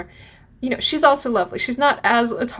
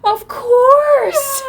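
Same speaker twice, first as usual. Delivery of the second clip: very high, loud laughing "of course"; delighted reply; 9 dB louder than usual. Retold or imitated. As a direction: burst out gladly, laughing. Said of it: "And she's like".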